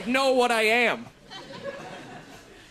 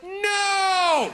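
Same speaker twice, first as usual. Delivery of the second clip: high, drawn-out mock "no"; emphatic refusal; 8 semitones higher than usual. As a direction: refuse in one long, high-pitched cry. Uncle retells scolding his nephew.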